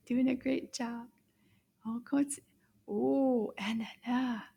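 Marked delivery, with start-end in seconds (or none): none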